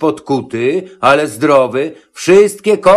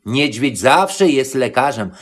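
ordinary delivery

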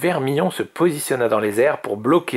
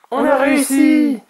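loud, very high cheer; high-pitched jubilant yell; triumphant cry; 7 dB louder than usual. A narrator's reading. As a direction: shout it out in victory. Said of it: "s'écrièrent Oria et Vermillon".

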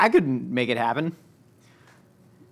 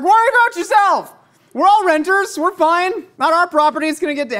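high, loud mock shouting; retold indignant yelling; 13 semitones higher, 5 dB louder than usual. [name] snaps back at alarmed neighbors, retold indignant yelling. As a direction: yell back defensively, pitched way up. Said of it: "then I started yelling".

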